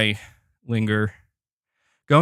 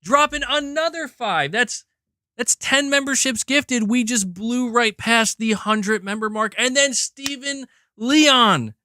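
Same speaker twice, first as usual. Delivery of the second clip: excited hype; 8 semitones higher than usual. Real, not acted. Hyping gift memberships.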